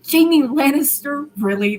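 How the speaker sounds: can't believe that name; incredulous. Acted. Arya hearing who started it, loud disbelief.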